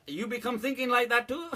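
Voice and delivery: high laughing broken English; mock broken English. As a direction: repeat it, cracking up. Repeats the mocked line, laughing.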